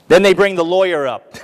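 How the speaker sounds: ordinary talk, nothing marked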